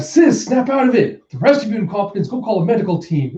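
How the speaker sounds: high-pitched, urgent ordering; urgent command. Acted. Brother to dazed sister and team, urgent command. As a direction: order everyone about in a panic.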